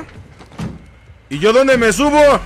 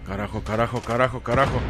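lower, much softer repeated curses; cursing under breath; 6 semitones lower, 8 dB quieter, faster than usual. Real, not acted. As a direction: mutter the curses to yourself. Mutters curses after dying.

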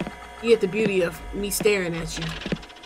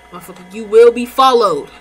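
loud threat; 8 dB louder than usual. Real, not acted. Threatening in-game thief.

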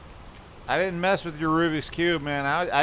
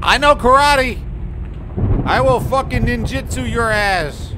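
much higher, louder bluster; mock tough threat; 7 semitones higher, 6 dB louder than usual. Gamer bluffing the monster with fake martial arts, mock tough. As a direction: bluff like a scared tough guy.

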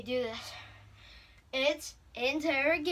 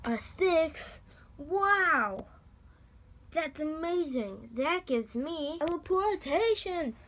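usual speaking voice

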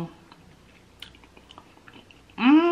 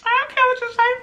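defiant; faster than usual. Real, not acted. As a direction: shrug off the critics. Defiant reply to viewers.

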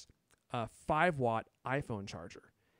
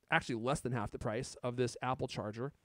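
ordinary talk, nothing marked

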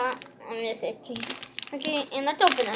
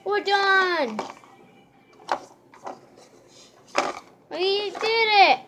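high and loud triumphant cheer; excited; 6 semitones higher, 7 dB louder, slower than usual. Pretend. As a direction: celebrate the finished trick. Pretend magic show.

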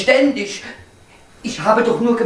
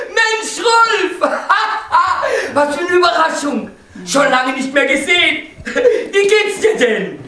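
much higher, laughing surprise; delighted greeting; 8 semitones higher than usual. Acted. Husband greeting his old friend Rolf on the phone, high and laughing.

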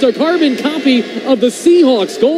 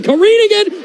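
excited build-up; 8 semitones higher, faster than usual. Real, not acted. Build-up to a goal call.

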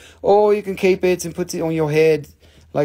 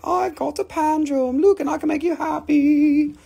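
gleeful pretend buyer; mock cheerful brag; 12 semitones higher than usual. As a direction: show off the drum like a happy kid. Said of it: "Or you can be like".

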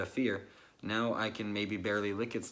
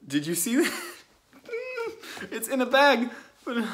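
laughing, much higher and louder; 13 semitones higher, 6 dB louder than usual. Real, not acted. Vlogger sees the spider in a bag, laughing loudly. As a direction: laugh it out in disbelief.

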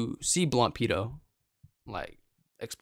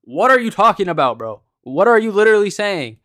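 loud, higher, incredulous yelling; hammed-up angry rant, louder and higher; exaggerated outrage; 7 semitones higher, 8 dB louder than usual. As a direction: blow up in mock disbelief at the opponent. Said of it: "I was exaggerating a little bit for a video".

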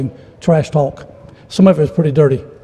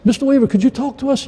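a hint of eager kids' voices; lightly voiced kids pleading; kids asking; 8 semitones higher, 4 dB quieter than usual. Kids begging the preacher to talk, lightly voiced.

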